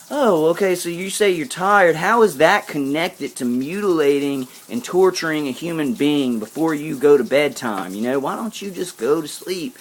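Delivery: high, loud, sarcastic yelling; retold angry yelling. Retelling yelling at the stalker about his excuse, high sarcastic yell. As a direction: throw his excuse back at him, shouting high. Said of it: "so I was yelling all that stuff".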